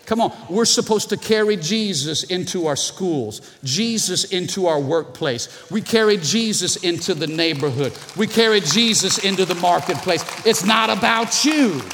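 full-force preaching climb. Acted. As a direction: preach it with growing fire. Building preached exhortation.

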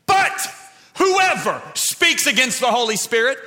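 high shouted scripture warning; full-force preaching. Real, not acted. Preacher warning with the words of Jesus, full-force preaching. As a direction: hit the first word hard, then proclaim.

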